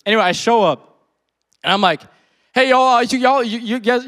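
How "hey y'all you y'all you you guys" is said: high, loud nervous stumble over the greeting; stammering. Retold or imitated. Preacher nervously greeting strangers in the car, stammering. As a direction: trip over the words, pitched up and loud. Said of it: "I'm, like, starting my words".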